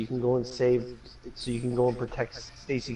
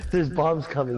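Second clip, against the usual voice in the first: normal talking voice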